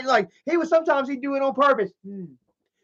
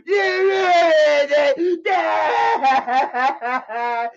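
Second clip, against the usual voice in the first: high, loud wailing "yeah"s; dramatic fake crying; 6 semitones higher, 4 dB louder, much slower than usual. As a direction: ham up the crying to get Dad's attention. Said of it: "make the cry as dramatic as he could".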